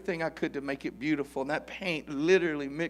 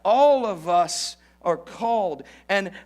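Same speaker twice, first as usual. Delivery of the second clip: louder, higher, slow and emphatic preaching; full-force preaching; 7 semitones higher, 7 dB louder, slower than usual. Acted. Preacher urging his congregation to learn, full force. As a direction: drive the lesson home to the church.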